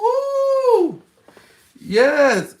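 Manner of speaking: high, loud woo; excited whoop. Real, not acted. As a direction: whoop with joy. Midnight celebration cheer.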